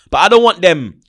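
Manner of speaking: usual speaking voice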